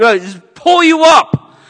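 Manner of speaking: loud, high, slowed pull; preaching surge. Acted. Preacher describing God's rescue, rising shout. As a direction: heave the words up.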